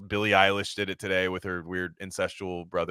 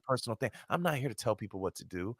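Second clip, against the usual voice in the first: much softer, hushed smooth mock voice; 2 semitones higher, 8 dB quieter, faster than usual. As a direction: coo it softly like a cool celebrity dodging the question. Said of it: "and he just goes".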